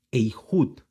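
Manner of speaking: "Eihud" with an ei glide and throaty h; Hebrew word demo. Shown as a demonstration. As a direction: sound out "Eihud" in two careful parts. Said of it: "porque es una G".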